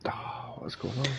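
hushed whisper. Real, not acted. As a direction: whisper it under your breath. Sudden whisper in the game.

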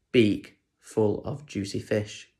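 ordinary delivery

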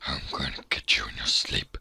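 deep, creepy villain whisper; Nathan. Acted. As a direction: whisper a low mock threat. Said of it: "I always thought his voice was like".

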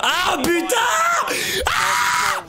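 high, drawn-out scream of exasperation; exasperated high-pitched yell; frustrated outburst. Real, not acted. Furious reaction to the game.

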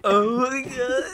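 laughing so hard it sounds like crying; crying with laughter. Real, not acted. Crying with laughter at a friend's impression, gasping laugh. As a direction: gasp it out through tears of laughter. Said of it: "I have a tears".

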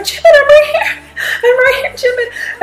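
high squealing call, laughing and half crying; fangirl squeal. Retold or imitated. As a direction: shriek his name across the arena. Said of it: "I'm like".

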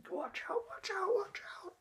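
airy falsetto taunt; mock wrestler entrance, squeaked; squeaky hype. Hyping himself before the match.

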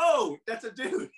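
laughing as he realizes the blonde is a man; laughing surprise. Retold or imitated. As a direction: caught out and cracking up.

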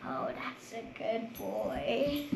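soft, slow dog praise; praising a pet. Real, not acted. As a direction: coo at the dog.

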